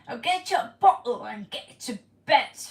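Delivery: loud, hammed-up British mum ordering a child to bed; British accent, bark it out like a strict nanny; British accent attempt. Viewer asks for a British accent, a loud British scolding. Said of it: "Can you do more British accent".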